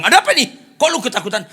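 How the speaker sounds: high, loud startled master; alarmed questioning. Retold or imitated. Big master asking frightened servant, alarmed.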